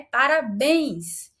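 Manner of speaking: Parabéns said slowly with the weight on the final béns; slow stressed demo. Shown as a demonstration. Teacher repeats the example for students, stressing the end. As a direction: stretch out Parabéns and land hard on béns. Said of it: "Parabéns vai levar o acento agudo".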